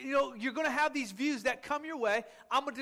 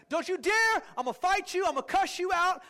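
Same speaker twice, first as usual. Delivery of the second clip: higher, louder mock fury; mock angry threat; 6 semitones higher, 4 dB louder than usual. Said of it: "A man's perspective is".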